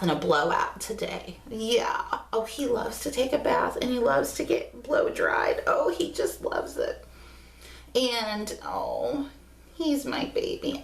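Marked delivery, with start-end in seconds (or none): 5.7-10.8 soft breathy whisper. Real, not acted. Mom whispering about her dog loving baths, hushed whisper. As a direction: whisper it fondly. Aside about dog's bath.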